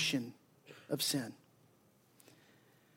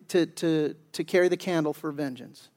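normal talking voice

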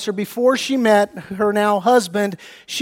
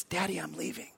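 a hushed whisper straight into the ear; whispering daughter; 5 semitones lower, 20 dB quieter than usual. Retold or imitated. Pastor imitates daughter sneaking out at night, whispering. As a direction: breathe it so nobody wakes. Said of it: "she whispers in my ear".

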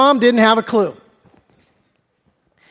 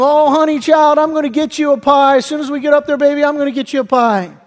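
higher-pitched cooing mother voice; imitated doting mom; 4 semitones higher than usual. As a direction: humor the child in a mock-sweet mom voice. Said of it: "And she says to him".

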